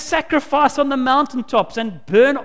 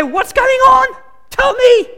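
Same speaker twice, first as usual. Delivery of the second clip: higher and louder, pressing for an answer; exasperated friend demanding the truth; urgent demand; 12 semitones higher, 5 dB louder than usual. Pretend. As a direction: insist he tell you now.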